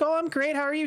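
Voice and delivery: high, cheery phone small talk; happy chipper. Retold or imitated. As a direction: imitate a breezy, upbeat exchange. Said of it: "happy chipper".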